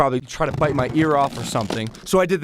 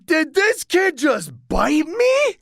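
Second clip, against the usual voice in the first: much higher, slow disbelief; shocked; 12 semitones higher, slower than usual. Retold or imitated. Bitten kid in shock, high and slow. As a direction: squeak in disbelief at the bite. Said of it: "I'm like".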